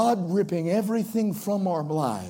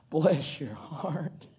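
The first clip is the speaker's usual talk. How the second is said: sarcastic bless your heart; 4 semitones lower, 8 dB quieter, much slower than usual. Shown as a demonstration. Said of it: "It's all in the voice inflection".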